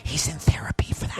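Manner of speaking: whispering on the co-host's behalf; a stage whisper as if covering for him; mock whisper for Lee. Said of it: "Lee's like".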